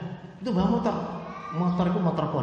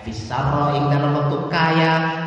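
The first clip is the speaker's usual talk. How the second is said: forceful preaching; 2 semitones higher, 9 dB louder than usual. Acted. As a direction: drive the verse home to the congregation.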